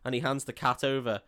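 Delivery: ordinary talk, nothing marked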